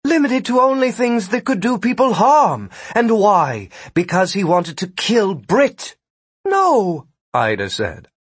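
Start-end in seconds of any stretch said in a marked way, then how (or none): 0.0-6.0 high, indignant accusation; galled. A narrator's reading. Gwen confronts Ida over the servant's powers, galled. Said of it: "Now Gwen was galled". 6.5-7.1 high, emphatic protest; indignant denial. A narrator's reading. Ida rejects Gwen's accusation, indignant denial.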